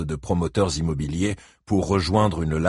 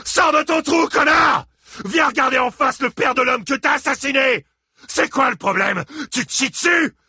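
enraged shouting. A narrator's reading. Father shouts to Christine that Gérald killed their son. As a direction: scream out the accusation.

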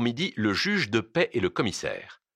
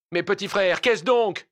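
high, raised appeal; loud, pitched-up outcry; cry from crowd; 10 semitones higher, 4 dB louder than usual. Acted. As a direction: call out in protest to your brothers. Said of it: "cria quelqu'un dans la foule".

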